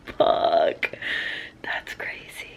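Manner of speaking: voiceless, awed whisper; stunned whisper. Real, not acted. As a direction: drop to a barely audible whisper of disbelief. Stunned reaction, under the breath.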